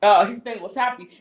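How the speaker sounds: ordinary talk, nothing marked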